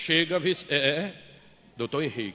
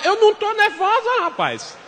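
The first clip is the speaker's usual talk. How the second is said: much higher, louder angry yell; furious shout; 14 semitones higher, 7 dB louder than usual. Retold or imitated. Wife to husband, furious shouted denial. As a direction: scream the denial.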